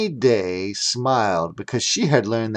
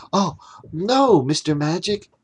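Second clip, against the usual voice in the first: gasping for air; 6 semitones higher than usual. Acted. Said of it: "while gasping for air".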